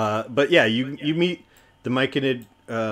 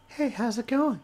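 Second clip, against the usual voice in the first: tiny, very high mushroom creature; Stool's little voice; 13 semitones higher than usual. Acted. Mushroom says hello inside a newcomer's head, tiny high voice. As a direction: pipe up small and high, like a little critter. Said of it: "You hear this little voice in your head".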